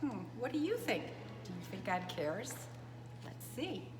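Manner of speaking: plain reading voice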